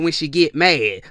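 ordinary delivery

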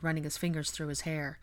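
ordinary delivery

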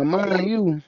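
usual speaking voice

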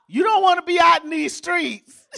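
laughing through the warning. Real, not acted. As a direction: chuckle "you don't wanna be out in these streets".

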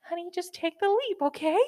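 high, sugary mock-sweet mom voice; passive aggressive mom. Podcaster mocking her listeners as a passive aggressive mom, sugary. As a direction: sweetly needle them like a passive aggressive mother. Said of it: "This is a passive aggressive mom".

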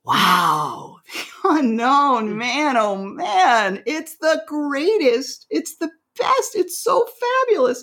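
the soul swooning over the unknown; delighted soul. Acted. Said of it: "the soul would say something like".

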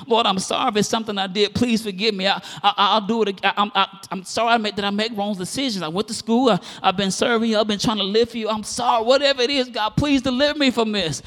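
imitating a pleading penitent; retold tearful pleading. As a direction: re-enact a desperate prayer without real tears. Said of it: "I'd be bawling, just, like, crying".

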